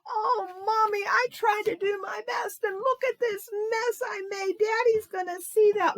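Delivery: high, loud, sobbing wail; crying child. Acted. Nancy crying to Mommy over the mess, high sobbing wail. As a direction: cry out like a little girl who ruined it. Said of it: "she started to cry".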